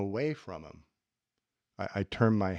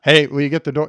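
loud, flat demand posed as a question; demanding ask; 3 semitones higher, 13 dB louder, faster than usual. Shown as a demonstration. Asking Mike again, now demanding. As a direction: stake out your territory and ask. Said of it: "Notice when I asked the second time".

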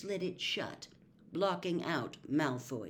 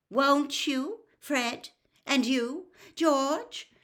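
higher, louder motherly call; 9 semitones higher, 7 dB louder, much slower than usual. Acted. Mother calls after her sons, raised motherly call. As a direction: call after the twins from the platform.

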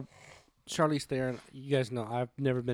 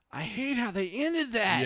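high, drawn-out mimic of a complaint; imitated frustration; 9 semitones higher, slower than usual. Quoting a coworker walking out of the theater, mock frustrated. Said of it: "she acted like she's frustrated in it".

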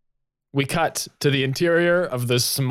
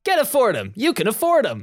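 commercial voice; 11 semitones higher than usual. Acted. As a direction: pitch it like a TV ad. Improvised ad slogan.

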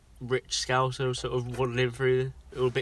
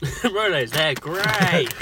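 laughing; 5 semitones higher, 5 dB louder, much slower than usual. Real, not acted. Laughing Rolo exclamation.

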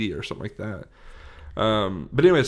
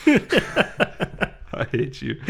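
laughing; 4 semitones higher, much slower than usual. Real, not acted. Laughing at the sandwich request.

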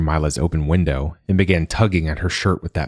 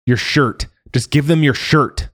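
higher, louder desperate yell; frantic shout; 10 semitones higher, 4 dB louder than usual. A narrator's reading. Joa yelling at Mila during the bird attack, frantic. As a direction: yell the fix in panic.

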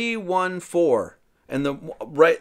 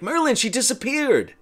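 put-on higher, urgent boy's warning; Wart alarmed; 9 semitones higher than usual. Wart warns Merlin the witch vanished, higher alarmed call. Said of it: "Wart on the side's like".

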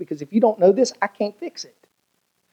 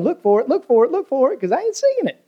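climbing higher with each repeat; rising exhortation; 8 semitones higher than usual. Shown as a demonstration. Pastor stirring the congregation, building higher each time. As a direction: build the call up in pitch to a peak. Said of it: "I say it again".

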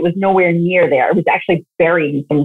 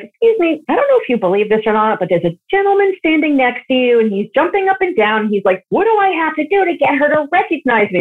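higher, eager stranger's voice; a hint of a breathless woman; lightly voiced stranger; 6 semitones higher than usual. Woman in the store telling the medium, lightly higher.

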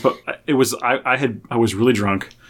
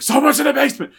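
much higher, panicked alarm; retold panicked warning, pitched way up; alarmed cry; 14 semitones higher than usual. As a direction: shout the alarm in fright. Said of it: "I was like".

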